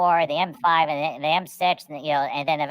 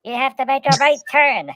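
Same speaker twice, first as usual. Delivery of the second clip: loud, high Cockney "Oi, Governor" sat-nav; Cockney GPS; 10 semitones higher, 8 dB louder than usual. Retold or imitated. British GPS scolding the driver, loud Cockney. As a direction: bark directions like a London cabbie. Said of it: "it says".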